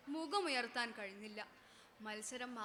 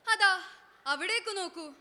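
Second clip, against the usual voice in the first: calling out; 5 semitones higher, 8 dB louder than usual. Acted. Storyteller calls attention.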